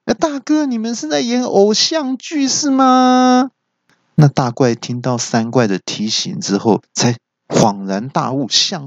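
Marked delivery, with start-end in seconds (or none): 0.1-3.5 高声尖细的吐槽; 提醒大哥的高声叫嚷; 三怪大叫. A narrator's reading. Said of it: "三怪就大叫说". (三怪高声吐槽)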